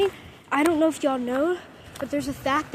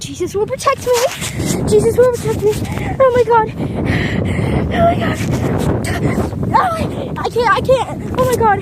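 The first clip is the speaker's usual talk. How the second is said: high loud desperate cries; panicked shouting; 9 semitones higher, 8 dB louder than usual. Real, not acted. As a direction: cry out against the storm. Prayer turns to loud cries.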